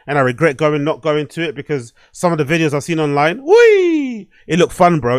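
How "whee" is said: excited whee. Real, not acted. Excited at party videos.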